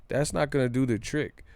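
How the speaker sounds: normal talking voice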